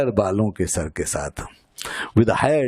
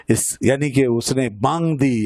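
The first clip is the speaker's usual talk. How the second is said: normal talking voice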